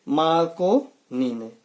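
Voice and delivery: slow, drawn-out drill answer. Shown as a demonstration. Teacher answering his own quiz question slowly. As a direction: spell the answer out slowly for students. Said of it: "radio की खोज किसने की?".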